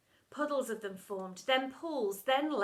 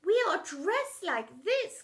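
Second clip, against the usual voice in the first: slow, higher, sugary lovey-dovey voice; slow higher soppy mother voice; 7 semitones higher, 5 dB louder, slower than usual. A narrator's reading. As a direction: coo it like a doting mum. Soppy Lovey-Dovey parent speaks.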